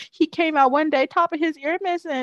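high, indignant neighbor complaint; mock Karen. Nosy neighbor calling to report dog abuse, mock Karen. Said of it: "I know a Karen, somebody".

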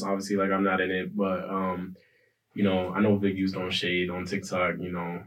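ordinary talk

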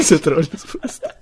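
laughing through the words. Real, not acted. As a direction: giggle while answering. Answering while laughing.

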